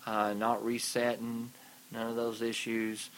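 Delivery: ordinary delivery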